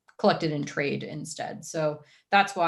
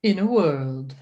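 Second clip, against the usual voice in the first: movie trailer voice; 6 dB louder, slower than usual. Acted. Co-host jokes about trailer narration, movie trailer voice. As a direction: boom it out like a film trailer. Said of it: "a movie trailer voice".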